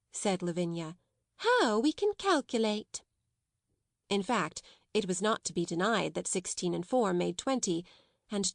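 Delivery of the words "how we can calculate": high, mockingly impressed; sarcastic. A narrator's reading. Lavinia mocking the sums the others make, sarcastic. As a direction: sneer it in a raised, swooping voice.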